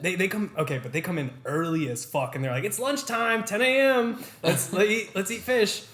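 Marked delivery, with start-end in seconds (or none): 2.6-5.8 put-on high, eager old-timer voice, pitched well up; mock old customers. Mocking old people who want fish at ten, high voice. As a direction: lampoon the early-bird diners, higher and louder.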